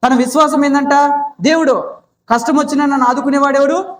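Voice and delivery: high, ringing call-and-response preaching; fervent preaching. Acted. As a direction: drive each answer home like a pulpit call. (ఉత్సాహంగా ఎత్తైన స్వరంలో ప్రబోధం)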